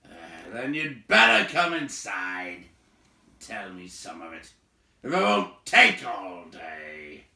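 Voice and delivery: loud, slow gruff grumble; grudging invitation; gruff host. A narrator's reading. Big man inviting them in, loud slow grumble. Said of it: "said the man".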